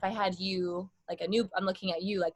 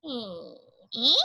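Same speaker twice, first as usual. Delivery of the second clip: thinned ee; 3 semitones higher, much slower than usual. Shown as a demonstration. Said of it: "thinning out the sound".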